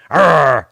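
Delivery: a short, loud growling "Arr!", retold and hammed up; loud mock growl for the photo. Retelling pulling a face for his school photo, loud mock growl. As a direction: bark out "Arr!" like a cartoon bully posing. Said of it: "like that".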